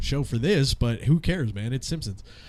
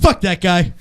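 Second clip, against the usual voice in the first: loud, higher snap; loud retort; 5 semitones higher, 11 dB louder than usual. Acted. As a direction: spit it back fast and loud. Running gag punchline.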